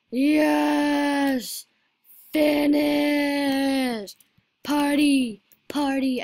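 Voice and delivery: loud, high drawn-out whoops; elated victory yell; triumphant cheer. Real, not acted. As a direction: shout in celebration of the win. Celebrates finishing the level.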